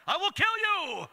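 high, slow, loud mock bellow; hammed-up threatening dad; echoing threat. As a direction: blurt the warning out, stretched and ringing. Father's threat echoing, climax.

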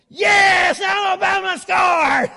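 high, drawn-out victory yell; full-force, slow football celebration shout; re-enacted scream. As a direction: scream it in a rival fan's face. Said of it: "screaming at you like that".

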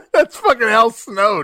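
can't get it out for laughing. Real, not acted. Co-host corrects a botched wrestler name, laughing. Cracking up at co-host's name mix-up.

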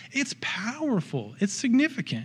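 high, emotional voice close to tears; tearful. Real, not acted. Preacher moved by new life in baptism, tearful. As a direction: pitch rising as real emotion wells up. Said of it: "I'm crying thinking about it right now".

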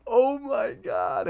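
high, drawn-out gasp of awe; amazed. Real, not acted. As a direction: squeal it out slowly in awe. Reacting to the scene.

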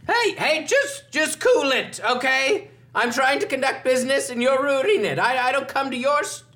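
indignant shopkeeper telling them off; high-pitched annoyed merchant. Acted. GM voices annoyed merchant NPC.

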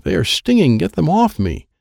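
high, contained scream; high-pitched plea, screamed lightly. A narrator's reading. Girl covered in stinging cards, mild scream. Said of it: "She's screaming".

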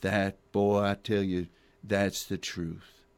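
a hint of a low Texan good old boy drawl; lightly voiced country sheriff, slow on the truth. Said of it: "the other sheriff says".

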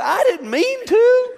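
high, slow voice with a laugh; sheepish son. Retold or imitated. Son answering dad's question in a high voice, laughing sheepishly. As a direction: play the guilty kid, laughing.

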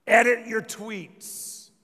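normal talking voice

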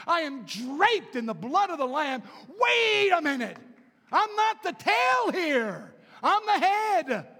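loud, high, slow declaration; triumphant shout. Acted. Preacher declaring victory to the congregation, shouted high. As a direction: proclaim it with full force.

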